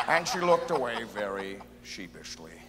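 very low narrator voice; super narrator. Acted. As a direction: narrate it deep and deadpan. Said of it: "what if God is like a super narrator".

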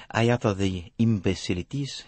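put-on gently amused French-accented detective; Hercule Poirot. A narrator's reading. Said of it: "Poirot laughed gently".

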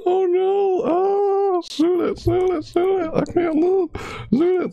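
very high falsetto mock panic; hammed-up squealed plea for help; basically screaming. Streamer re-enacting being stuck in the tentacle, high mock screaming. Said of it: "while I'm basically screaming".

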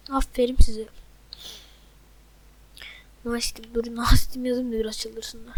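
sarcastic whisper. Real, not acted. Taunting teammates under his breath.

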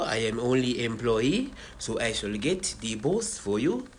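ordinary talk

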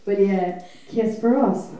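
ordinary talk

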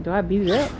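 ordinary talk, nothing marked

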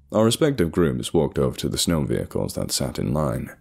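usual speaking voice

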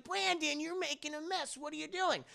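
put-on very high-pitched kid voice; scolding little girl. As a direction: scold the dog like a small child. Little girl scolding her dog.

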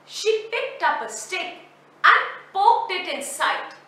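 usual speaking voice

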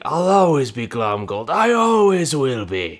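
lightly voiced cartoon villain; Glomgold line. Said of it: "he said it again".

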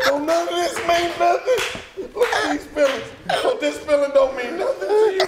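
high squeaky mock sob over dropped feelings; mock heartbroken. Man mocking his girlfriend's shattered feelings, high mock sob. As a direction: ham up a wounded girlfriend crying.

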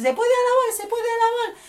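high mocking chant of churchgoers; mimicking churchgoers. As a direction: parody the pious refrain. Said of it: "Que mucha gente dice".

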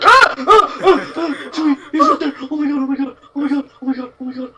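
panicked high screams; scared horror game streamer. Real, not acted. Monster right there, panic.

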